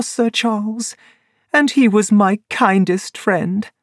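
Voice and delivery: high, bitter woman's voice; a betrayed woman confessing; high voice of a woman betrayed by her lover; Laura Lyons. A narrator's reading.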